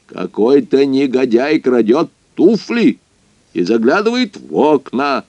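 high, loud, self-important boss; pompous loud Moses voice. Acted. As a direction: bark orders at the innkeeper. Moses voiced through scene. (высокий громкий голос Мозеса)

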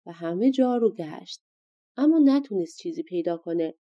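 usual speaking voice

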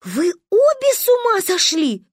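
high, loud tearful wail; crying. A narrator's reading. Narrator as child scolding sister and friend, crying. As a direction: wail at both of them in mock tears. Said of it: "заплакала я".

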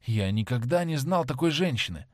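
usual speaking voice

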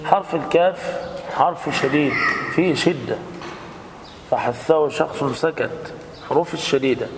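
ordinary delivery

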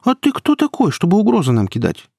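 high-pitched defiant retort; girl's high, challenging cry; shouting. A narrator's reading. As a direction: fire back indignantly, starting very high. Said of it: "крикнула Кира".

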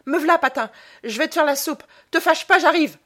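high, loud, placating shout; shouting through ceiling. A narrator's reading. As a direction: call out anxiously to appease him. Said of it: "Elle cria à travers le plafond".

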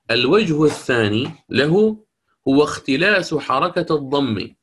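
usual speaking voice

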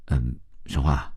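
slow, low, tentative opener; hesitant. A narrator's reading. Uneasy man broaches a question while driving, hesitantly. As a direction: feel your way in with a slow hum. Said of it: "他当下心中忐忑起来".

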